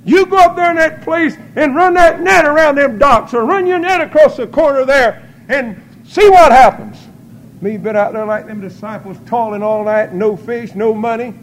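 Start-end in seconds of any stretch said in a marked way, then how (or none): 0.0-7.1 high, loud preacher's command; urgent ringing orders, imitating a guiding voice; commanding inner voice. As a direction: call out instructions with full pulpit force. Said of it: "That little voice would come to me".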